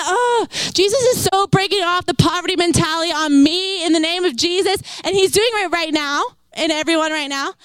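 ordinary talk, nothing marked

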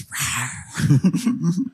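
put-on playful toddler-style lion roar; little daughter's lion roar. Dad showing his daughter's favorite animal sound, playful roar. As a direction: roar like a toddler playing lion, half laughing. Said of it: "She goes".

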